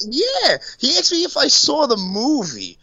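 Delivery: incredulous. Real, not acted. Disbelief retelling the actor's question.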